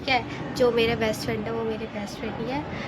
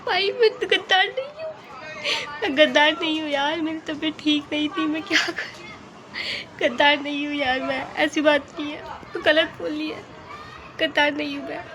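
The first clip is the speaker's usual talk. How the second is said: whiny protest; 4 semitones higher than usual. Acted. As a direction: protest like a wounded friend. Defending herself against "traitor" charge. (ऊँची, रूठी हुई आवाज़ में सफ़ाई)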